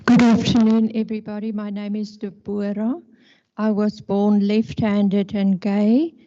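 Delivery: ordinary delivery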